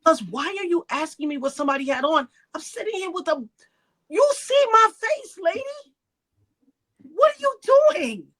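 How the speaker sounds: high, incredulous protest rising in pitch; mortified disbelief. Retold or imitated. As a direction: build to an outraged, high-pitched retold challenge. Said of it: "I was mortified".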